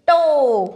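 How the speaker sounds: टो loud, with the "oh" held; teacher's model टो with a stretched "oh"; long O sound. Shown as a demonstration. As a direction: draw out टो as retroflex "tohh". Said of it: "तो इसको हम पढ़ेंगे".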